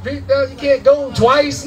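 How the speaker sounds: ordinary talk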